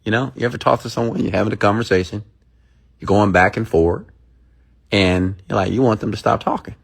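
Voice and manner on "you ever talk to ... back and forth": ordinary talk, nothing marked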